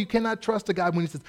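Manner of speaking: ordinary talk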